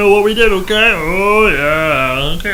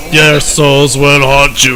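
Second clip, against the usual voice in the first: deep, loud, menacing boss; low villain voice; 8 semitones lower, 3 dB louder than usual. Acted. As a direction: speak as an ominous game villain. Voices a game character.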